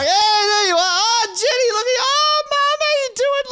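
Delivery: high, over-the-top friendly greeting voice; mimicking a beaming official. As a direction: wave to the crowd and gush hello to everyone.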